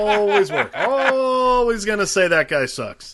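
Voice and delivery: high, long drawn-out "Always"; emphatic ALWAYS. Real, not acted. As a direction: stretch "Always" as if it lasts forever. Comic exaggeration of forever.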